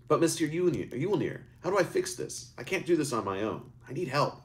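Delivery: put-on a boy begging the wizard for help; pleading. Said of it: "Eric pleaded".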